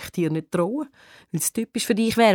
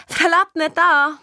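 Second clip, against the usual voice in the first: adoring call; 10 semitones higher, 3 dB louder, slower than usual. A narrator's reading. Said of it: "ruft verliebt".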